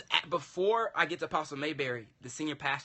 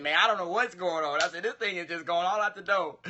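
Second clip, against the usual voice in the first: louder, pitched-up exasperation; exasperated; 4 semitones higher, 7 dB louder than usual. Retold or imitated. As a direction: throw up your hands in the retelling. Retold frustration to other church.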